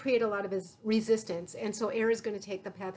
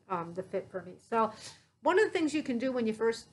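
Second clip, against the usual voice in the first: muffled behind respirator; speaking into n95 mask; through a face mask. Real, not acted.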